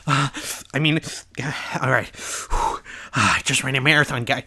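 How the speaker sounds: imitating heavy puffing between words; mock out of breath. YouTuber mocks his winded old recording, mock panting. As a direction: huff and puff in exaggerated exhaustion. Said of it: "I don't know why I'm out of breath".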